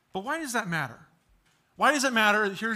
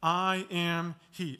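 slow, weighty I am he; simple and short; slower than usual. Shown as a demonstration. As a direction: repeat it slowly with weight. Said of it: "We looked at that last week".

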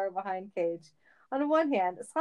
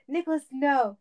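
higher, urgent "no"; protesting cry; 5 semitones higher, 3 dB louder than usual. A narrator's reading. Sonic trying to stop Cage, urgent protest.

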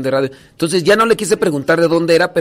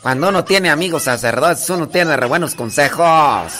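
slow, drawn-out Tarimoro lilt; imitated Tarimoro village woman; Tarimoro accent. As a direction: mimic a pleading Tarimoro comadre, stretching the endings. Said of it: "la de Tarimoro se habla así".

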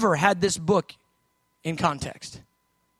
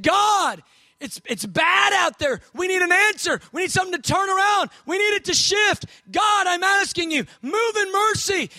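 pleading cry; 7 semitones higher than usual. Acted. Prophet pleading with God.

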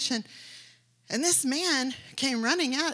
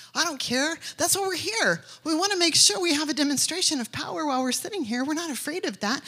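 high, loud, defiant cry; fervent preaching; 6 semitones higher, 4 dB louder than usual. Real, not acted. Preacher defying the devil before the church, loud and fervent. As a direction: declare it with fire in the voice.

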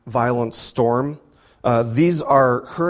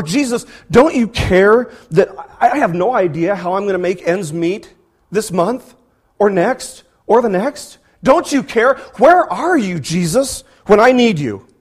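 high, loud anguished cry to Jesus; desperate plea; 5 semitones higher, 6 dB louder than usual. Acted. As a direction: cry out as if abandoned. Voicing a desperate believer.

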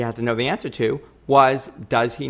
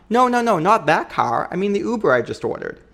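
hurried correction; 7 semitones higher, 4 dB louder than usual. Retold or imitated. Correcting fiancé about the car.

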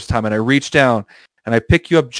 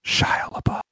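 hushed, fully whispered mock reveal; dramatic whisper; 9 dB quieter, slower than usual. Storyteller springs a fake reveal on his listener, dramatic whisper.

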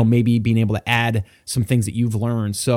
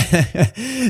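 laugh; 6 semitones higher than usual. Real, not acted. Host laughs.

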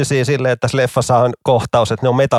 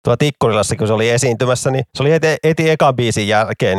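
ordinary talk, nothing marked

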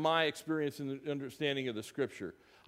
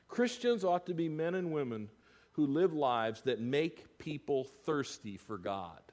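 ordinary delivery

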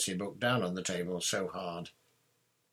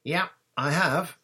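much higher, louder reply; emphatic admission; 9 semitones higher, 9 dB louder than usual. A narrator's reading. Harry admitting he saw the dog, emphatic. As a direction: come straight out with it. Harry answers Ron's worried question.

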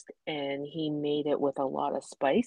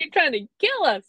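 exclaiming; 10 semitones higher, 5 dB louder, faster than usual. Retold or imitated. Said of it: "I'm like".

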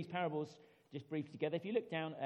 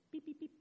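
mimicked beeping; 11 semitones higher, 6 dB quieter, faster than usual. Imitating the metal detector beeping.

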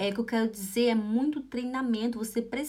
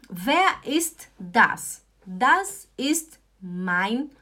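very slow model; 3 dB louder, much slower than usual. Shown as a demonstration. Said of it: "teacher models slowly".

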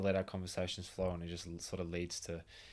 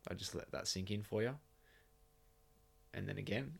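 softer line left hanging after a long pause; demonstrated pause; 6 dB quieter than usual. Shown as a demonstration. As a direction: let the silence sit before "again". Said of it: "chuck a quick pause in".

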